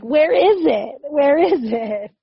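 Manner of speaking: hammed-up panic over something lost; mock panic. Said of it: "I'm like".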